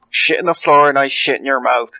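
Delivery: loud, badly faked West Country accent; terrible West Country accent. Player quoting a man grabbing someone's mouth, terrible West Country accent. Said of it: "That was a terrible accent".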